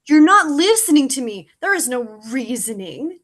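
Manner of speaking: exasperated outburst. Acted. Crow frustrated with Scarecrow.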